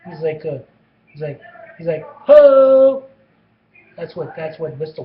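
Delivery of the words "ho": big, much higher shout of Ho in a put-on Mexican accent; shouted greeting. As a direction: bellow it like a brash coach.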